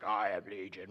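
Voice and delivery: low, otherworldly many-voiced demon; demon's multi-voiced voice. Preacher voicing the possessed man answering Jesus, low demon voice. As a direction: speak as a legion of demons at once. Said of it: "like, a multi-voiced voice".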